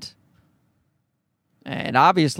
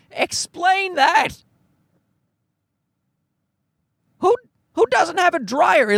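incredulous; 10 semitones higher, slower than usual. Real, not acted. Ranting about a house without dryer.

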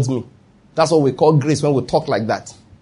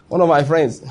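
laughing; 5 dB quieter than usual. Real, not acted. Laughs starting funny story.

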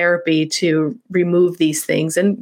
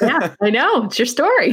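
high-pitched, laughing agreement; amused, laughing; 7 semitones higher than usual. Real, not acted. Host agreeing with guest about their own story, laughing. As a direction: say it through a real laugh, pitched up.

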